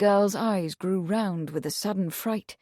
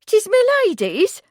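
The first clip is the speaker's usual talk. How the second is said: put-on tearful young maid; 13 semitones higher, 8 dB louder than usual. A narrator's reading. Meg tearful.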